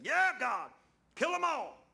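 high, loud, shrill mockery of a zealot; mock bloodthirsty prayer. Preacher mocking a zealot who wants everyone killed, high loud mock shout. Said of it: "I'm not one of them guys go up there and say".

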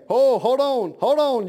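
acted objection, voice lifted; mock interruption. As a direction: cut in loudly on a prayer. Acted interruption of someone praying.

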